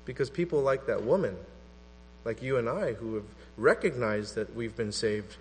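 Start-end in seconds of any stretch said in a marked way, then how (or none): none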